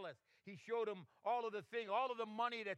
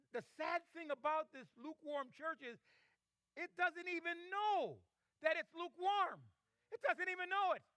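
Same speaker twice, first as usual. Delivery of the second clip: impassioned preaching; 8 semitones higher than usual. Real, not acted. Preaches with raised voice.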